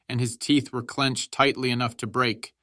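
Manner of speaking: ordinary delivery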